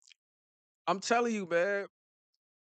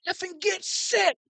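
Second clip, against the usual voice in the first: high, strained mock shout of a quarterback barking orders; a pitched-up imitation of a field general calling the line; quarterback yelling orders; 3 dB quieter than usual. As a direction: play Brady hollering at his linemen. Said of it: "yelling at his linemen".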